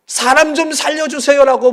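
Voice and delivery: high, loud yell up the cliff; screaming for anyone passing above; yelling for help. Retold or imitated. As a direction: yell at the top of your lungs. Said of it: "목이 터져라고 외쳤습니다".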